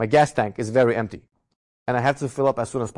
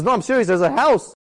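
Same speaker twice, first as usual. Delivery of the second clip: imitating an earnest wife, pitched higher; insistent wife; 7 semitones higher, 3 dB louder than usual. Wife insisting in acted exchange.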